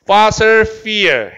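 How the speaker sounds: fossil fuel, slow, both begun with F; F sound demo. Shown as a demonstration. Teacher modelling for students to repeat, slow fossil fuel with F sounds. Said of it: "얘도 F 사운드야".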